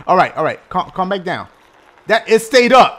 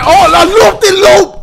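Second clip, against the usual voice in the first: excited; 10 semitones higher, 9 dB louder than usual. Real, not acted. Excited at game loop.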